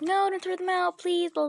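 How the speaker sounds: high-pitched retold begging; mock pleading. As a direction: recap the plea in a high voice. Replays the plea to dad.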